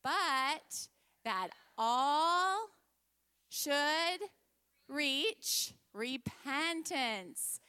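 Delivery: slow, measured scripture recitation; group recitation. Real, not acted. Teacher leading children in a verse, slow, deliberate recitation. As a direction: stretch each word so others can follow. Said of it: "let's say it all together".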